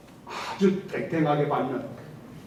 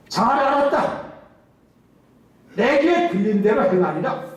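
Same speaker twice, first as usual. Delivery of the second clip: God's solemn verdict; 5 semitones higher, 5 dB louder than usual. Retold or imitated. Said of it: "하나님께서 뭐라고 말합니까".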